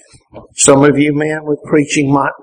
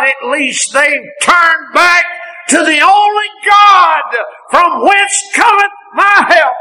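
preaching; 9 semitones higher, slower than usual. Acted. Preacher building to a fervent peak. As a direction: climb into full pulpit fire. Preacher rising to full force.

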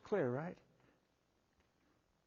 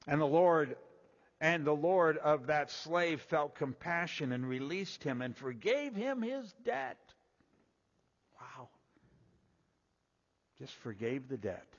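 raised, measured reading of the parable; scripture reading; 3 semitones higher, 5 dB louder than usual. Acted. Preacher reading scripture to the congregation, raised and measured.

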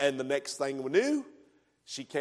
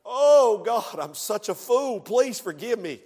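repentant prayer; 6 semitones higher, 6 dB louder than usual. Retold or imitated. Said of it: "Yes, prideful".

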